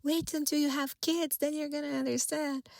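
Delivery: imitating an upset mother, high-pitched all through; upset mom. Upset mom telling her child they don't understand, imitated upset voice. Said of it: "like, upset, she would say".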